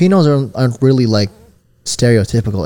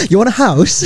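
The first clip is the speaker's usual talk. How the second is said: high-pitched mock British sales pitch; British estate agent; 9 semitones higher, 3 dB louder than usual. Host teasing friend as a British realtor, mock British. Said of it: "Imagine Kyle as a British real estate agent".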